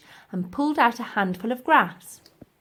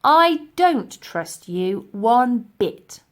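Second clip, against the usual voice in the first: fury; 3 semitones higher, 7 dB louder, much slower than usual. Acted. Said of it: "Elsie was glowing with fury".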